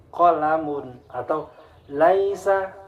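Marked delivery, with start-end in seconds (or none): 1.9-2.7 LAISA starting with open 'lai'; thin lam. Shown as a demonstration. Teacher gives correct example, thin lam. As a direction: keep a light 'a' after the L of LAISA. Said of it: "nggak boleh O".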